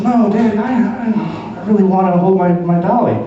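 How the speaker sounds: put-on high pleading little-girl voice; whiny little girl. Comedian mimics his daughter whining to keep her doll.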